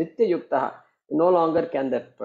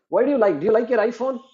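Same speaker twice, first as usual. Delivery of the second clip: higher, lightly voiced sage quizzing a student; teasing question; 9 semitones higher, 5 dB louder, faster than usual. Sage asks his wife about her phone, higher and teasing. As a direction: pitch it up, playful probe. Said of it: "And he says".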